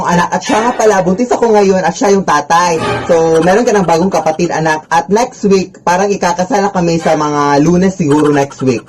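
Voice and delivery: ordinary talk, nothing marked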